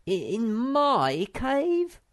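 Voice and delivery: high, slow squeaky proud voice; proud squeak. Acted. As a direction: squeak with pride. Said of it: "squeaked Sniff proudly".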